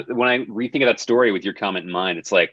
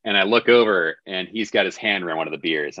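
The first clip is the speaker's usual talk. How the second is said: ordinary delivery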